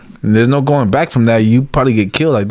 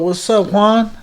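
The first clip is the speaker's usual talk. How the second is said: rising, higher call to Juan; friendly greeting; 9 semitones higher, slower than usual. Retold or imitated. Greeting an angry cellmate, high and friendly.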